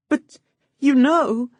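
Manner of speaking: high, loud put-on sobbing; through sobs. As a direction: sob it out for show. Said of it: "I said through my sobs".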